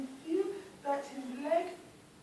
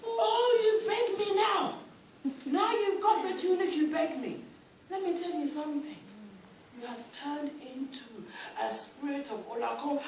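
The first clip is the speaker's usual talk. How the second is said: scornful scolding; 5 semitones higher, 10 dB louder than usual. Acted. Ruler scolds Oba.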